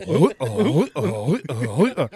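loud mock retching, like a cat with a fur ball; retching noises. Imitating a wrestler about to vomit on the bus, loud retching. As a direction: gag and heave out loud. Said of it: "It's like".

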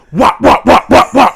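loud, high mouth gunshots wop wop; mock gunfire sounds; gunshot noises. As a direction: shout out the shots like a pistol firing. Imitating gunshots.

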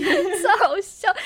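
high, slow words through real laughter; can barely get it out for laughing; laughing hard. Real, not acted. Laughing at her own story.

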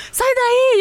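high yell to break up a dogfight; shouting at the dog. Retold or imitated. Woman yelling at her dog to let go, high shout. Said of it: "eu comecei a gritar".

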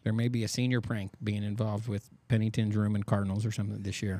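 ordinary delivery

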